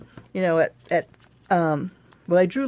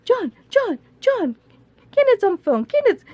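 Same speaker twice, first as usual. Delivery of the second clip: put-on much higher, louder Chinese-accented calling; imitating Bunny's Chinese accent calling out; 14 semitones higher, 5 dB louder than usual. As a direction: call across the church in Bunny's Chinese accent. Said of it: "she still has her little accent and stuff".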